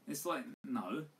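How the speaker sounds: ordinary talk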